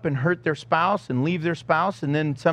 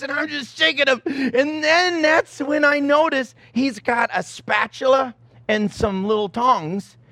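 ordinary delivery

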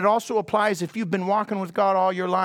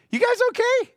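surprised question. Retold or imitated. Surprised at giddy gangsters.

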